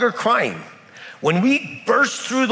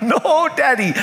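higher, whiny mock cry of a small boy; a hint of a crying boy pleading; crying child; 8 semitones higher, 3 dB louder than usual. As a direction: wail it like a scared little kid. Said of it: "He cried".

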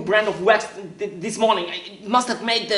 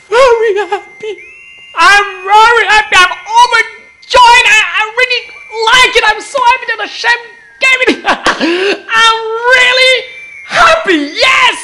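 much higher and louder, shouting for joy; ecstatic shout; 11 semitones higher, 8 dB louder than usual. Acted. Janitor shouts his joy at the job to the visitor, ecstatic. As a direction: burst out overjoyed, laughing and near tears.

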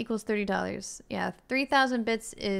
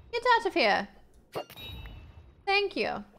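ordinary talk

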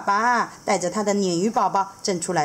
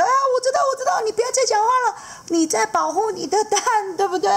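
much higher, fast and chatty; eager seahorse dad; 3 dB louder, faster than usual. Acted. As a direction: rattle it off, knowing and playful. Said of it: "然后海马爸爸就".

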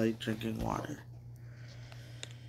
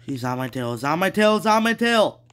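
from tense to loud, high panic; building panic; 5 semitones higher, 9 dB louder, faster than usual. Real, not acted. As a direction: panic rising with each repeat. Monster chasing, panic builds.

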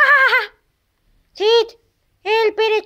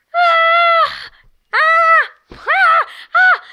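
high, repeated scared shrieks; frightened screams; 7 semitones higher, much slower than usual. Acted. Startled by the stranger, screaming in fright. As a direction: shriek in panic, over and over.

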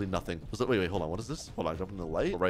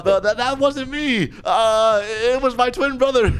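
high, stammering excuse; panicked denial; 8 semitones higher, 3 dB louder than usual. Acted. Player lying to kidnapper about a twin, panicked. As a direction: blurt a flimsy lie in high-pitched panic.

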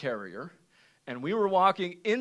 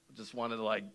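usual speaking voice

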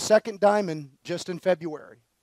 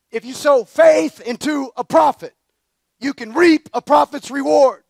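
loud, higher preaching cry; full-force preaching; 6 semitones higher, 8 dB louder than usual. Acted. Pastor urging the congregation to sow into prophets, preaching loudly. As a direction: shout it to the back pews.

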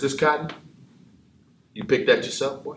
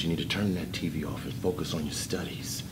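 normal talking voice

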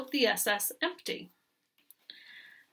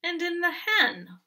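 higher mock moan with a falling end; 7 semitones higher than usual. A narrator's reading. Hen adding herself to the goat's list, moaning. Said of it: "moaned the hen".

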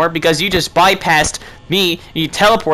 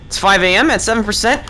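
normal talking voice

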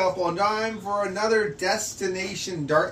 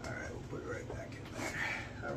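murmured whisper; 16 dB quieter than usual. Real, not acted. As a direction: whisper it to yourself. Replacing dart quietly.

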